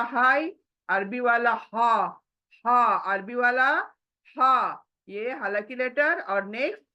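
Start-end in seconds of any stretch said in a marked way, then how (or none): none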